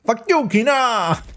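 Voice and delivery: hammed-up defiant yell; mimicking angry defiance. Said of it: "I just love the delivery there".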